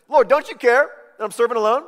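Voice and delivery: loud, high, carrying across the room; calling out over crowd. Retold or imitated. Martha calls across crowded room, loud and high. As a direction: shout to the front of the room. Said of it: "She's like".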